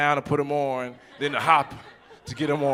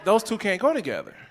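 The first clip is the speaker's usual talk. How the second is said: higher, scolding; 7 semitones higher than usual. Retold or imitated. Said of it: "I'm like".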